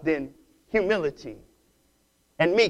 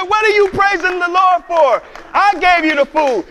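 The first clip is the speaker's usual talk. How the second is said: baffled protest; 9 semitones higher, 6 dB louder than usual. Retold or imitated.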